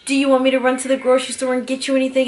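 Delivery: quick, bright eager offer; lightly hammed-up doting husband. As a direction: play the eager helpful husband. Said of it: "and he's like".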